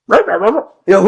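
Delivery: high, loud mock croak; frog voice. Boy singing at his bar mitzvah, froglike. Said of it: "he sounded like a frog".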